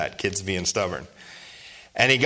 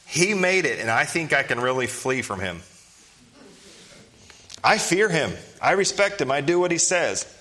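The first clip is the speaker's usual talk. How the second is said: ironic, pitched-up mock boasting; mock Jonah; 4 semitones higher than usual. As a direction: parody the hollow claim, voice up high. Mocking Jonah's claims.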